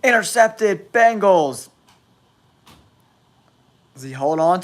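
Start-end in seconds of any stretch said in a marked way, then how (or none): 0.0-1.7 high-pitched interception call; excited sportscaster; excited play-by-play. Real, not acted. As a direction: announce the turnover, pitched well up.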